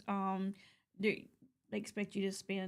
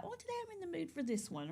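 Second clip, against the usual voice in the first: playful musing; 8 semitones higher, 9 dB quieter, much faster than usual. Retold or imitated. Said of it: "trade it out".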